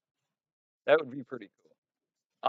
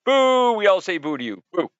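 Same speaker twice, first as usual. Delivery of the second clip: loud jeering boo; booing shout; 8 semitones higher, 9 dB louder than usual. Pretend. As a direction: boo him like a crowd. Booing the absent friend.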